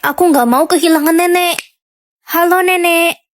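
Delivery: ordinary delivery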